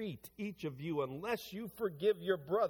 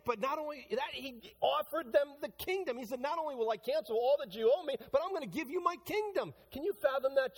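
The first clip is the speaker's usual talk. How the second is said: much higher, rising fervent sermon voice; preaching at full force; 10 semitones higher than usual. Acted. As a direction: build to a passionate climax for the congregation. Preaching climax on the king's mercy.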